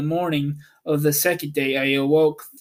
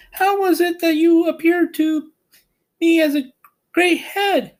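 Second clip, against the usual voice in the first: put-on high, girlish and louder; young Dorothy; 4 dB louder than usual. A narrator's reading. Dorothy asking Oz about the great head, high girlish voice. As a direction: ask it like a curious little girl. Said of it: "answered Oz".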